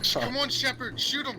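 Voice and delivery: high urgent cry; pleading yell. Real, not acted. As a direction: beg him to shoot, high. Urging player on screen.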